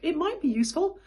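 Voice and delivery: high voice for petting a puppy. Shown as a demonstration. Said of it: "talk all the way up there".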